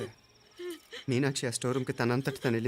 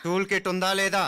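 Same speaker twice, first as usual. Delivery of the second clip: raised, higher-pitched interrogation; insistent demand; 6 semitones higher, 4 dB louder than usual. Acted. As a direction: press hard for a straight answer. Same question pressed after no answer.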